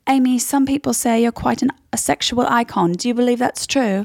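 high-pitched British interviewer. Acted. Interviewer asking [name] about being an icon, British. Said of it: "It's [name] asking [name]".

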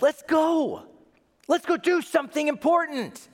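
high, loud, eager urging; rally him at the top of your pitch; urgent rallying. Acted. Visitor urging boy Jesus out of the workshop, urgent.

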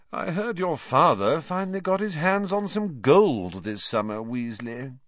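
high-pitched, taunting drawl; high drawl, easing off at the end; Malfoy's lazy drawl. A narrator's reading. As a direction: needle them in a high, lazy voice. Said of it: "in his usual lazy drawl".

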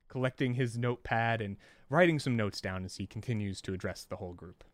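ordinary talk, nothing marked

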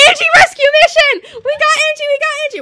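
very high, loud triumphant scream; excited screaming. Retold or imitated. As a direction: scream it as you sprint. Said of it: "We're, like, screaming".

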